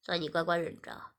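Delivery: lightly voiced master pleading his excuse; low master voice. Master excusing himself to Shiya, low and coaxing.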